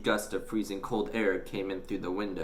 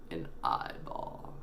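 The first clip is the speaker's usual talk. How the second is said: whispered; 6 semitones lower, 8 dB quieter, slower than usual. A narrator's reading. Horrified reveal.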